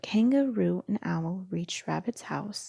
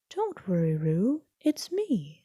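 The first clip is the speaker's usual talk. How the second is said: Owl reassuring. A narrator's reading. Owl reassures frightened Roo.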